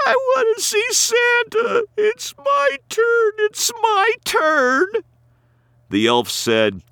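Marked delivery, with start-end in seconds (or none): 0.1-4.9 put-on a small child pleading for her turn; little girl. A narrator's reading. Crying little girl demanding her turn with Santa, very high and whiny.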